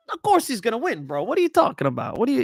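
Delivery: lightly hammed-up AJ brushing off doubters; mild mock random accent; imitating AJ. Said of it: "he'll just start going accents".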